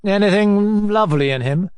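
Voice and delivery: offhand, mildly insolent; insolent carelessness. A narrator's reading. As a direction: toss it out with lazy insolence. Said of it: "answered Obnoskin with insolent carelessness".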